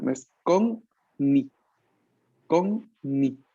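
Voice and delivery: konni with a held double n. Shown as a demonstration. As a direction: stretch the nn in konni slowly. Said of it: "Atención".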